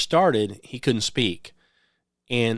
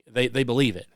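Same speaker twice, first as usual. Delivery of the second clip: normal talking voice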